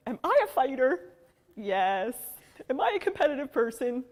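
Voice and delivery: mother rallying her daughter, loud and rousing; rousing pep talk. Retold or imitated. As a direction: pump up a scared child.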